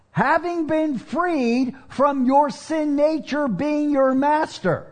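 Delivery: high, rising preacher's exhortation; preaching at full force. Acted. Preacher urging his church, rising in passion. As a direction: drive the point home, climbing.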